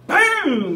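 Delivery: loud, high voiced gun blast; big drawn-out shotgun boom; imitated gunshot. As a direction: shout the shot as a sound effect. Imitates his shotgun going off.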